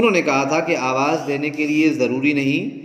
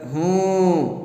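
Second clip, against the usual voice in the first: sick groan; 4 semitones higher, much slower than usual. Acted. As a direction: moan like a bedridden man. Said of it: "कराहने की आवाज़".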